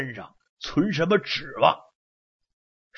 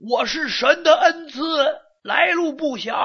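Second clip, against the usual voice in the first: high, loud boastful cry; shouting; 10 semitones higher, 4 dB louder than usual. Acted. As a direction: proclaim it at full voice. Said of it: "四哥也高声呐喊".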